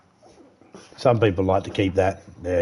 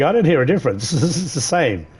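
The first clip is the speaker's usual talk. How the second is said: higher-pitched, amused imitation; laughing mock listener; 6 semitones higher than usual. Streamer imitating listeners who miss the difference, laughing. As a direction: chuckle through the imitated reply.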